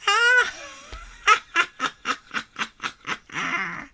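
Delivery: very high wild witch cackle; shrieking witch laugh. A narrator's reading. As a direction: cackle like a crazy old witch. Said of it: "cackled like a crazy old witch".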